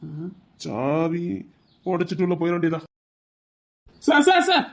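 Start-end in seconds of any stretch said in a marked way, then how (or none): 4.0-4.6 high, loud calls of sir with a laugh; calling out. Acted. A nervous assistant calling out to the new officer at the door. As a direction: call him over eagerly, half laughing.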